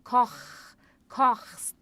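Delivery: Koch and kochst with the ch rasped in the throat; ch from the throat, like clearing it gently; throaty ch. Shown as a demonstration. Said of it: "o C H que a gente faz aqui na garganta".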